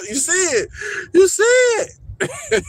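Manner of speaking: high-pitched squeal through laughter; laughing. Real, not acted. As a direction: crack up while pointing it out, voice shooting way up. Cracking up at what he spots.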